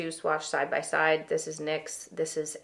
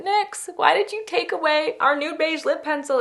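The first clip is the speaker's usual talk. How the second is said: mock whiny complaint; 9 semitones higher, 7 dB louder than usual. Retold whiny complaint to brand.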